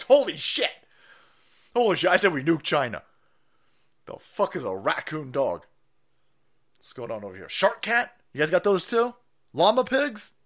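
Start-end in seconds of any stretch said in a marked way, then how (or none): none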